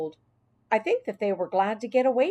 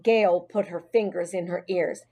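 ordinary delivery

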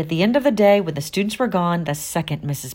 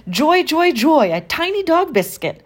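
very high, loud delighted joy joy; 12 semitones higher, 4 dB louder than usual. A narrator's reading. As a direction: squeal with glee. Janitor cheerfully voiced.

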